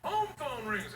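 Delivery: usual speaking voice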